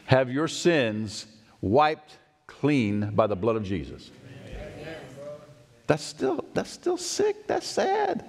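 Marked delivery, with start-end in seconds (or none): 5.9-8.2 squeaky high excited cry, much higher; excited squeal. Real, not acted. Preacher thrilled at forgiven sins, squealing high. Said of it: "Are you kidding me?".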